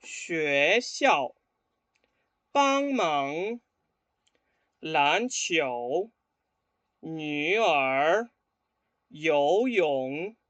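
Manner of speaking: 跟我读的发音示范; repeat-after-me drill. Shown as a demonstration. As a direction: model each word for students to echo. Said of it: "escuchen y repitan después de mí".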